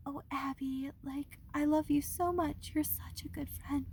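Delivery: mock-sweet whisper; imitating a fake-sweet friend in a whisper; breathy sugary fake friend mocking Sarah; sweet little voice. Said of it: "like, sweet, like, little".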